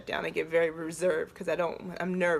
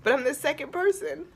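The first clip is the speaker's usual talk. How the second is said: higher and louder, joking; goofy joke; 9 semitones higher, 4 dB louder than usual. Retold or imitated. Woman joking to the speaker about suicide, high playful quip. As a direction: cover the pain with a joke. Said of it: "I'm a goofball".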